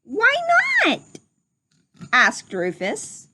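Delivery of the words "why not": piglet voice. Acted. Rufus asks.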